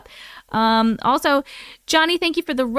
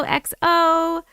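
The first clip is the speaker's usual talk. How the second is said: ordinary talk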